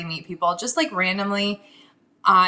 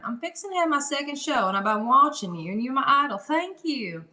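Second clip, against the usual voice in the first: put-on Southern accent, pitched up; sweet Southern gal; 4 semitones higher than usual. New seller gushing to her idol, in a sweet Southern accent. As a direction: play the admiring Southern newcomer. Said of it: "sound like a sweet Southern gal".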